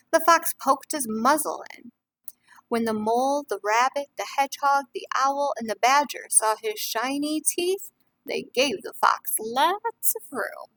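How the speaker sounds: lively storybook reading to a class; reading to children. A narrator's reading. Teacher reading a picture book to the class, lively. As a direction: read it warmly to young listeners.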